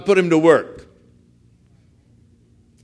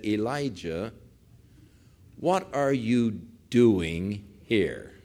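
very slow, measured question; solemn divine question; 5 semitones lower, 5 dB quieter, slower than usual. Retold or imitated. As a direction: draw each word out with pauses between. Voices God questioning Elijah in cave.

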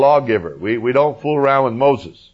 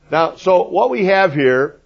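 normal talking voice

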